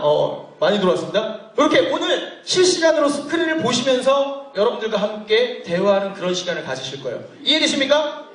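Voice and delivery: usual speaking voice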